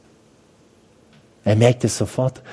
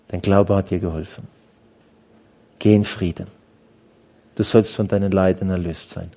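tender blessing; 6 semitones lower than usual. Acted. Jesus's words repeated.

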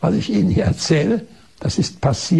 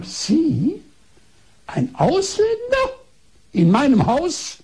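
much higher, stretched outraged surprise at a foreigner; indignant disbelief; 9 semitones higher, slower than usual. Retold or imitated. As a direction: squeal it like a scandalised doctor. Doctor indignant at foreign patient.

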